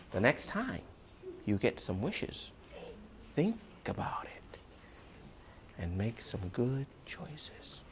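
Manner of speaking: soft and confiding; hushed moral. A narrator's reading. Storyteller giving children the moral in a whisper.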